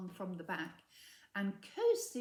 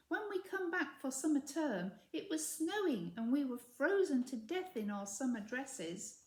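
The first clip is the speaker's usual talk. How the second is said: higher, louder, indignant schoolgirl voice; Mildred's complaint; 6 semitones higher, 4 dB louder than usual.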